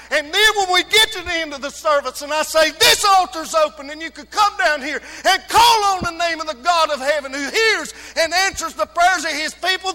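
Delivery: loud, high preacher's cry; full-force preaching. Acted. Pastor to his congregation, preaching at full force. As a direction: preach it out to the whole church.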